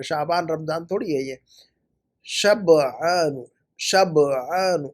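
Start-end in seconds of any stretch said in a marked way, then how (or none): 2.2-4.9 شبعان stretched as "shab ... 'aan"; very slow شبعان, split after the b; correct pronunciation. Shown as a demonstration. Teacher breaks the word down for student, correct pronunciation. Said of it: "شب، رات کے لیے جو لفظ".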